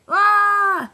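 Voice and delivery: loud high-pitched scream. Acted. Engine screaming as a crane lifts it. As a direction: shriek as you're lifted. Lifted into the air.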